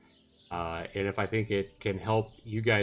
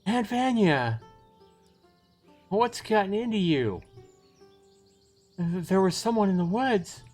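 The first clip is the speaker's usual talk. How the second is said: high, mildly panicked character voices; startled alarm; 10 semitones higher, 4 dB louder, slower than usual. Acted. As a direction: play it high and urgent. Frightened girl meets aunt, acted.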